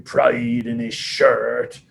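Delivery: lightly mimicked booming team captain. Captain giving team orders on pride, lightly booming. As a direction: boom it out a little, like a captain. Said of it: "booming out some orders".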